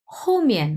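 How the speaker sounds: হোমিয়ান with both syllables falling from high; two falling tones. Shown as a demonstration. Teacher models হোমিয়ান, both syllables falling. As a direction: drop pitch on each syllable of হোমিয়ান. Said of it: "এটা দুটাই হচ্ছে চার number tone".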